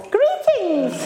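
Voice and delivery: high-pitched, drawn-out comic voice; Blackadder. Shown as a demonstration. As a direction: squeak it out high and silly. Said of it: "high-pitched, slightly Blackadder voice".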